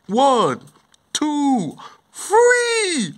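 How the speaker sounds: rising, high-pitched count-in; rallying countdown. Acted. Countdown to a group shout.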